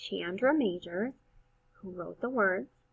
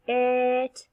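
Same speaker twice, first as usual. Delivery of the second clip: stretched sounds; 3 semitones higher, 4 dB louder than usual. Shown as a demonstration. Teacher showing first graders stretched-out sounds. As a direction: draw each sound out long. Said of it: "stretch 'em out like this".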